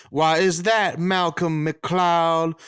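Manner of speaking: slow, drawn-out Southern belle gushing. Pretend. Belle admiring Malcolm's chin, slow Southern drawl. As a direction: swoon slowly over his chin like a belle.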